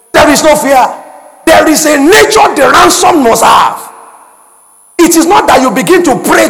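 fiery preacher building up; preaching at full force. Acted. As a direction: drive the point home to the congregation.

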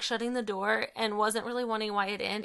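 ordinary talk

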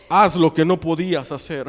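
loud televangelist command; forceful, authoritative order; voice of authority. Retold or imitated. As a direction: boom it out like a faith healer. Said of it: "Así, con voz de autoridad".

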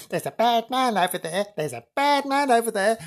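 high, put-on ghostly girl; hammed-up high girl; young girl's voice. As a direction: warn them in a little girl's voice. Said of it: "a young girl's voice saying".

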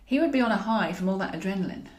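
usual speaking voice

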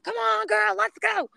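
high-pitched cheering. Retold or imitated. Runner cheering her friend on the last sprint. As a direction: urge her up the hill. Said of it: "I'm like".

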